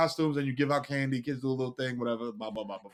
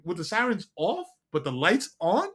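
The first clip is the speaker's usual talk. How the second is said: ordinary delivery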